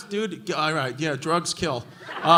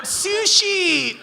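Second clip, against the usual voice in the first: much higher shrieked Sushi; mock piercing squeal of the word Sushi; 9 semitones higher than usual. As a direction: screech it like the drunk girl in the crowd.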